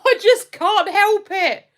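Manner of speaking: high, loud mock-indignant protest; exaggerated retold protest; protesting innocence. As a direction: plead your case loudly, up high. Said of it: "people think I'm taking the mick".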